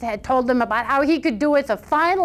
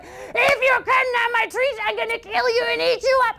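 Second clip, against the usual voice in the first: roaring troll; 12 semitones higher than usual. A narrator's reading. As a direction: roar it like an angry troll. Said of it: "troll screaming and roaring".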